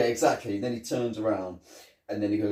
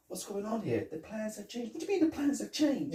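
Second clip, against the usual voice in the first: squeaky confused voice; 12 semitones higher than usual. Retold or imitated. Imitating scene dialogue.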